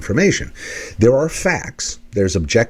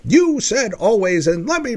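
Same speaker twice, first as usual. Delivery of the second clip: mock challenge; 9 semitones higher than usual. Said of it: "go challenge and say".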